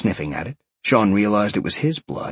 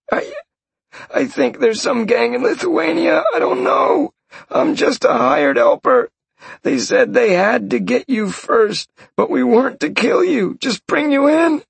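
high pleading voice of a beaten man; terrified captive; 3 dB louder than usual. Acted. Captured mage swearing to Sean he killed nobody, high and pleading. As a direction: beg him in a high panicked voice.

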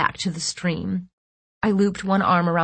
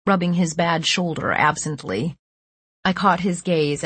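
ordinary delivery

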